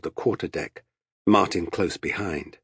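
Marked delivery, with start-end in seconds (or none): none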